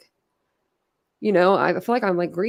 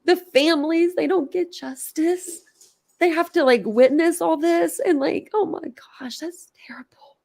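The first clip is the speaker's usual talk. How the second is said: high, tearful, fading to a whisper; choked up, sinking into a whisper; real tearful grief; 5 semitones higher than usual. Real, not acted. Streamer grieving for the victims' families, tearful. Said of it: "I just cried on a live".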